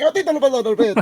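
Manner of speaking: high-pitched mock cursing in Spanish; laughing, squeaky cartoon tirade; Mario. Host acting out cursing at the ape, mock tirade. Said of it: "starts cursing him".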